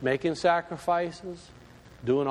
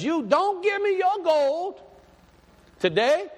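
high, drawn-out prophet's cry; preaching protest; 13 semitones higher, 3 dB louder than usual. Acted. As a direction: call it out high and insistent. Acts John preaching against money.

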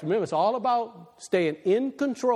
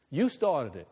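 ordinary delivery

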